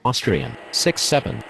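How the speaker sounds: ordinary talk, nothing marked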